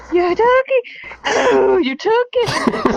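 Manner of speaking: high, laughing fake cry; laughing mock wail. Playful outrage, laughing.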